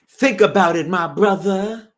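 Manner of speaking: high, loud preachy conspiracy voice; Bam impression. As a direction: lean in like a street philosopher. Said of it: "Bam ended sentence with".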